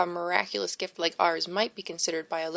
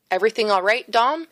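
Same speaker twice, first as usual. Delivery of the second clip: louder, higher call; bearded man calling to the priest, louder; 3 semitones higher, 6 dB louder than usual. A narrator's reading. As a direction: call across the breezeway.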